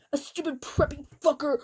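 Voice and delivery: ordinary talk, nothing marked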